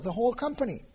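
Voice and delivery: ordinary talk, nothing marked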